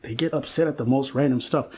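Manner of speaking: ordinary talk, nothing marked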